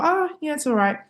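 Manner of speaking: high, half-hearted okay; lukewarm approval. Retold or imitated. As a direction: shrug it off lightly. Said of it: "I just thought".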